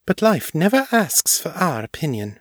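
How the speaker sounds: high-pitched, measured gravity; sober. A narrator's reading. Mistress reminding Morgan about life, high and sober. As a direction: state a hard truth soberly. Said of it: "Ava said soberly".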